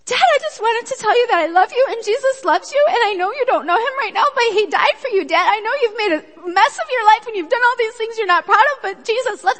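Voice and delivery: high, loud, fast outpouring; gushing plea. Retold or imitated. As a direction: rush it out in one breathless gush. Friend pours gospel out to dad.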